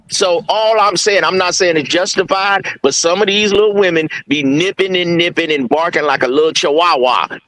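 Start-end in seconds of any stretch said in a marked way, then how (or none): none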